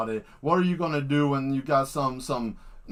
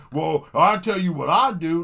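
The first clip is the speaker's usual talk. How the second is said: mock tough guy; 4 dB louder than usual. Mocking tough guy boast.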